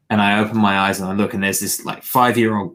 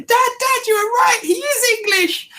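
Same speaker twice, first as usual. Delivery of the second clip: put-on squeaky, much higher child voice; excited little boy. Boy on the beach calling excitedly to his dad, in a squeaky high child voice. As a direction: shriek it like a thrilled five-year-old. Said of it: "He's going".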